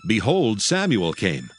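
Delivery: ordinary talk, nothing marked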